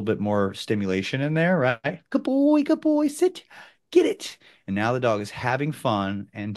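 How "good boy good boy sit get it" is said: high, bright, loud praise for a dog; excited dog praise. Shown as a demonstration.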